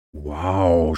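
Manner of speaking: understated wow of wonder; amazed. A narrator's reading. Ben sees the waterfall, mildly awestruck. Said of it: "staunte Ben".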